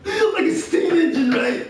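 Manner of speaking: barely getting it out for laughing; talking through a laughing fit. Real, not acted. Laughing while describing it.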